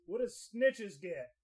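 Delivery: slow, loud and deliberate; slow loud command. Real, not acted. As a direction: spell it out slowly for the machine. Slow loud question to device.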